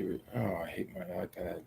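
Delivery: usual speaking voice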